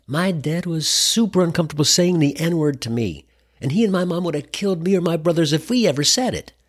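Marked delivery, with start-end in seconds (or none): none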